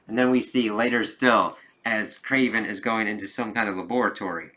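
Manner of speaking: normal talking voice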